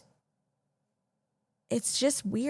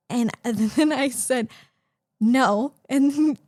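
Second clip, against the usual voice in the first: laughing; 3 semitones higher, 4 dB louder, much slower than usual. Real, not acted.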